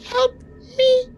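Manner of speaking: high, small pleading cry for help; tiny plant voice. Acted. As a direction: squeak out a plea like a little plant. Plant calls out to players.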